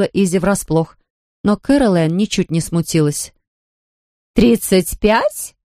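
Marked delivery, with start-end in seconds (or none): 4.3-5.5 slow, drawn-out coy purr. A narrator's reading. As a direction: purr it out like a femme fatale. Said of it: "промурлыкала она".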